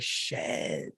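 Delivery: hushed, whispered retold curse; stunned whisper. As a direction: whisper it as the lyric hits home. Retold reaction to hearing a lyric.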